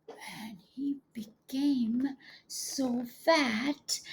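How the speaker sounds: slow, drawn-out whisper; hushed, slow storybook whisper. Acted. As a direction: whisper it slowly for the children. Dramatic moment in the story.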